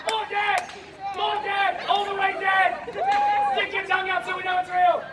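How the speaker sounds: high-pitched insistent stage direction; high urgent directing. Acted. Showman coaches volunteer playing dead, high insistent calls. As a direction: call out commands high and sustained.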